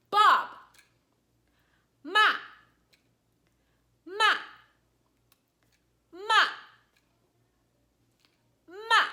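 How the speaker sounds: angry "Bob" and "ma", high and falling sharply; frustrated, forceful drop on "ma"; fourth tone. Shown as a demonstration. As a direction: snap each "ma" down loudly from high pitch. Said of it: "you're angry at Bob".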